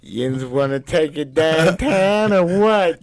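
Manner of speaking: slow, put-on Pittsburgh Yinzer come-on; slow, sleazy Yinzer flirt in a thick Pittsburgh accent; Yinzer pickup line. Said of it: "pickup line in a Pittsburgh accent".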